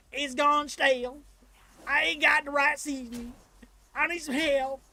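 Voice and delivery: high mocking call to the turkey boss; taunting boss voice. Gamer taunting the turkey boss, high mock voice. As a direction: egg the monster on in a high put-on voice.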